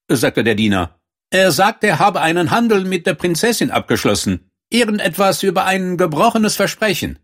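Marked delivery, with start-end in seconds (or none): 1.3-7.2 high-pitched servant voice; servant reporting. A narrator's reading. Servant reports the frog's claim to the princess, high-pitched report. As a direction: relay the message in a high voice.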